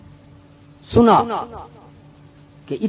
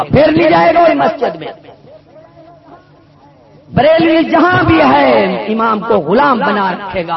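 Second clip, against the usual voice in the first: fiery preaching; 4 semitones higher, 6 dB louder than usual. Real, not acted. Fiery preaching against rival sect.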